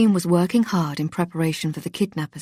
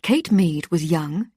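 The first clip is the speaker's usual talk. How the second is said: ordinary delivery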